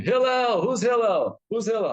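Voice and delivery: high, loud impatient yelling of a name; retold scream. Man banging on Hillel's door Friday, screaming his name. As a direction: shout for Hillel like a rude troublemaker at the door. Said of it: "some guys go screaming".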